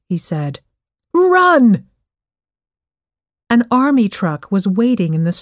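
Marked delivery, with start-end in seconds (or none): 1.1-1.9 high, loud shouted command; loud alarm call, pitched way up; urgent shout. A narrator's reading. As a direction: yell it to get them fleeing. Soldier urges escape from bees.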